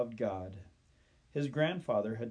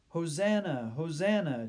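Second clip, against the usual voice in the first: raised, high-pitched mock cheer; cheering crowd; 4 semitones higher, 4 dB louder than usual. A narrator's reading. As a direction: cry out like a crowd greeting a king. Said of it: "cheered the crowd".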